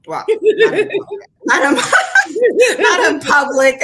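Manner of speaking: high, loud, laughing through the words; real laughter breaking up the phrase. Real, not acted. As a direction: crack up while admitting it. Laughing at her own admission.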